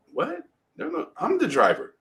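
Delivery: high-pitched baffled protest; incredulous. Retold or imitated. Crash victim to the witness searching for remains, retold incredulous. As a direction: correct them in retold disbelief. Victim retells his disbelief.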